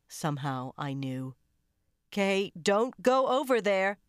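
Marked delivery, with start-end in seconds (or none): 2.1-4.0 louder, higher warning; raised voice. A narrator's reading. McGovern warning Kay away from the remains, raised voice. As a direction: call her back firmly. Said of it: "McGovern raised her voice".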